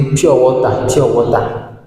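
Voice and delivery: "pure water" as "piow ɔta", open o; African "pure water". Shown as a demonstration. Teacher showing how Africans say pure water, the local way. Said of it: "Ici en Afrique, on dit".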